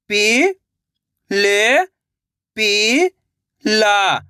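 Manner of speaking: "पि-ल-पि-ला" very slowly, each syllable held; sounding out slowly. Shown as a demonstration. Teacher showing children how to sound out a word. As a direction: sound out "पि-ल-पि-ला" piece by piece. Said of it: "एक एक अक्षर का उच्चारण करके".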